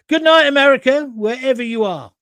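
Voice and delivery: high, loud imitated radio sign-off; broadcast sign-off. Presenter signing off to America, loud imitated catchphrase. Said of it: "It was his sign-off".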